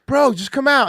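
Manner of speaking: high, loud party-bro impression; imitating Alex. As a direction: pump it up like an excited bro inviting a friend. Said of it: "And he's like".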